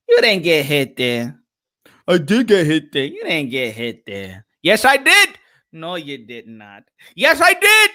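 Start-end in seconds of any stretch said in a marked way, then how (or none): 4.6-5.3 insistent retort. Acted. Mock argument with himself. 7.2-7.9 insistent retort. Acted. Mock argument with himself.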